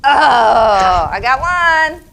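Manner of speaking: loud, rising cheer of success; excited. Real, not acted. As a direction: celebrate scoring a point. Scoring during the game.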